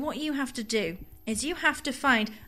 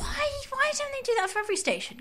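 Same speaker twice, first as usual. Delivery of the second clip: high, fast and puzzled; faster than usual. Retold or imitated. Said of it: "I was like".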